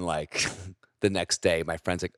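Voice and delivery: usual speaking voice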